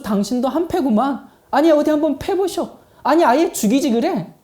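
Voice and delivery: 억울해서 예수님께 따지고 드는 연기; 큰 소리로 되묻고 배짱 부리듯 도발하기; 대드는 큰아들. Acted. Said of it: "예수님께 달려들지도 모르겠습니다". (어디 해보라는 듯 대들며)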